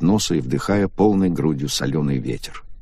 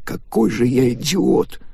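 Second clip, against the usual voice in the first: higher, slow bitter self-blame; self-reproaching young man; 6 semitones higher, slower than usual. Acted. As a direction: scold yourself, devastated. Takaaki blames himself.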